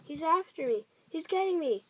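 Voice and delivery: high, loud cries of terror; panicked scream. A narrator's reading. Giant thrashing about madly, loud high scream. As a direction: yell as if being chased down. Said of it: "screamed the flesh lump eater".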